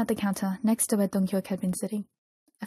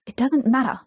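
louder, irritated brush-off; snapped; 3 semitones higher, 5 dB louder than usual. A narrator's reading. Dong-hyuk cuts off Jisung's questioning, snapping. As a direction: snap back to shut the question down. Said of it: "Dong-hyuk snapped".

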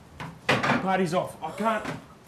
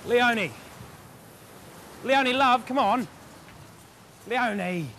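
calling out; 7 semitones higher than usual. Acted. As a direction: call after her across the yard. Calling after wife.

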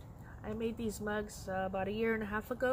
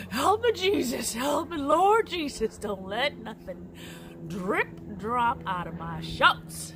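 comic loud plea for help; desperate prayer; 3 semitones higher, 7 dB louder, slower than usual. Retold or imitated. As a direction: beg Jesus in a hammed-up panic. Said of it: "and you're like".

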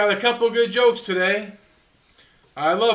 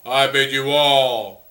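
grand sign-off; 7 semitones lower, 3 dB louder, slower than usual. Acted. Sign-off flourish.

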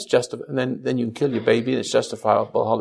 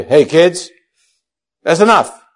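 louder, firm mock scolding of the kids; mock stern; 2 semitones higher, 6 dB louder, slower than usual. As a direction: put on a show of being mad, but without real anger. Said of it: "that sounds like I'm mad at them".

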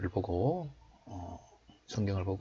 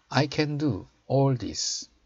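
slow, raised teaching voice; slow model reading; 4 semitones higher, much slower than usual. Shown as a demonstration. Teacher showing learners the English verse, slow model reading. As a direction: read it out slowly for learners to copy.

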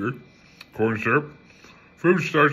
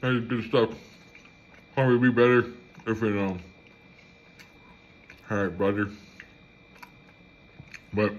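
lips against cup rim; muffled by drinking cup; speaking into a cup. Real, not acted. Seen 0: Cup held at lips throughout; muffin bites only before, at -4s.

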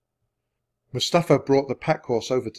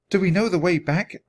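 higher-pitched, lightly voiced girl's question; Annie's voice; 6 semitones higher than usual. A narrator's reading.

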